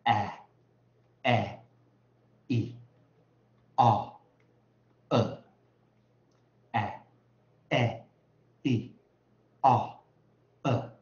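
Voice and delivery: A, E, O each cut short and loud; quick, loud bursts of A, E, O, one by one; short vowels. Shown as a demonstration. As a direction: punch out short vowel sounds A, E, O with pauses. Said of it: "短的我就念什么".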